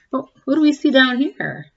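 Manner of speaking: usual speaking voice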